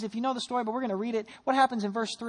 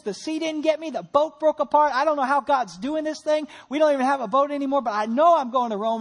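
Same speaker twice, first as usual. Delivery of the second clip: loud, higher-pitched preacher's declaration; defiant conviction; 4 semitones higher, 8 dB louder than usual. Acted. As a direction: build into a forceful, unshakable declaration as Paul. Paul defying setbacks, acted out.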